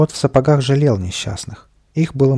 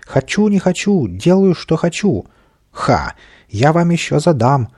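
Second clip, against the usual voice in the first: high, drawn-out bratty wailing; tantrum yelling; 6 semitones higher, 4 dB louder than usual. A narrator's reading. Little man threatens everyone around, yelling tantrum. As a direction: yell defiance in a lifted voice. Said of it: "а Каприска вопил".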